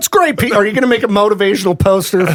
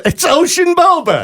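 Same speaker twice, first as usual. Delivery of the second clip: much higher, delighted announcement; gleeful; 11 semitones higher than usual. Real, not acted. Repeating his cohost's joke phrase, gleefully high. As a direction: proclaim it like a punchline.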